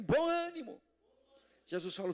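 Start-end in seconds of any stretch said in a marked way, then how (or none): 0.0-0.8 high, loud call to the congregation; punchy, high-pitched exclamation; rallying call. Shown as a demonstration. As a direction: call it out for everyone to repeat. Leads congregation to repeat it.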